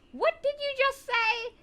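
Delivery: high, slow, loud strict-teacher outburst; very loud demand. Retold or imitated. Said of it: "said very loudly".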